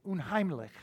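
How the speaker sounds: Unheimlich with a German ch at the back of the throat; German word unheimlich. Shown as a demonstration. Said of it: "here's the German word. You're gonna say it after me".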